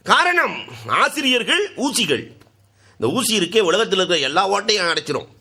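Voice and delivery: high, ringing sermon voice; fiery preaching. Acted. Preacher exhorting the beloved listeners, high and ringing. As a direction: preach it out to the crowd.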